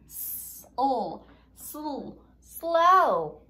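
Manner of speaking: S, O, sl, then "slow" blended and stressed; sounding out slow. Shown as a demonstration. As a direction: stretch out "S", "O", "sl", then say "slow" loud. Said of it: "You hear the S sound and you hear the L sound".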